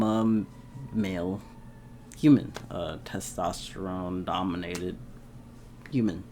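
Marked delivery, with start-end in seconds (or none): none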